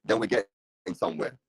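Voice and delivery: crackly, cutting-out live-stream audio; broken mic. Real, not acted. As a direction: voice breaking up through a shorting mic. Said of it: "I sound like staticky".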